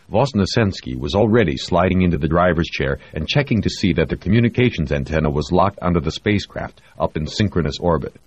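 usual speaking voice

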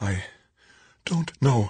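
halting high-pitched answer; frail, uncertain reply; weak, faltering woman. A narrator's reading. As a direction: falter after the first word, pitch high. Weakened victim answers her rescuer.

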